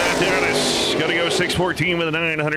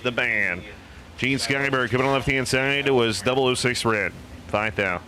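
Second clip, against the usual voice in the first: usual speaking voice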